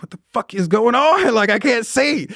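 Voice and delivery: high, baffled, with a laugh; replaying his confusion, voice jumping up; confused outburst. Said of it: "and I'm like".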